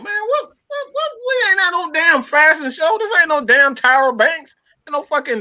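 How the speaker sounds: high-pitched, exasperated protest; worked-up, incredulous objection; indignant rant. Real, not acted. Mocking others' complaints about clothes.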